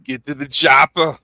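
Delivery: loud Austrian action hero line; Schwarzenegger impression.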